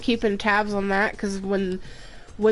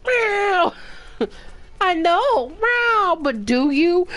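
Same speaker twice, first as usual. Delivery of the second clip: cat voice; 11 semitones higher, 5 dB louder, much slower than usual. Pretend. Voicing her cat meowing.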